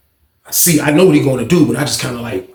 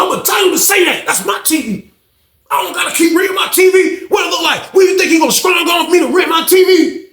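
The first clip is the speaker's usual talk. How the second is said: extremely high, worked-up mimicry; changed, outraged voice. Fonz rants about renting his T V, imitated in high outrage. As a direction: act out his squealed indignant rant. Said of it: "His voice got different and everything".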